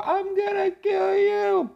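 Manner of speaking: very high, drawn-out spooky wail; eerie falsetto ghost moan. Acted. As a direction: wail it slowly like a haunting ghost. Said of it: "Una fantasma dijo".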